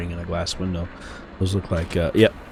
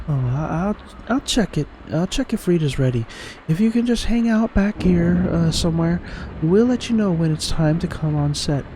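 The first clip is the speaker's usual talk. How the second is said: higher-pitched game character voice; Emily; 11 semitones higher than usual. Acted. Station worker greeting an early visitor, lightly feminine voice. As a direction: lift the voice for a helpful station worker.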